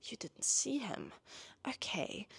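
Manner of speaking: hushed, whispered thinking aloud; soft, slow private realisation; inner voice. Acted. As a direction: whisper a suspicious thought to yourself. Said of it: "in her head, she goes".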